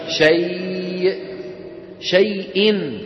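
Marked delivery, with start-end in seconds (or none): 0.1-1.3 very slow شيء with a stopped ending; pausal form. Shown as a demonstration. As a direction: drag out شيء and cut it off. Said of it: "كيف نقف عليها".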